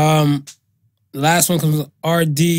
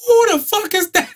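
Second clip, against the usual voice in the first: alarmed homeowner. Retold or imitated. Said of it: "I'm like".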